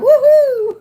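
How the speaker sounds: high, loud whoop of celebration; a big triumphant squealing cheer; excited woohoo. Real, not acted. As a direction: cheer like you finally made it.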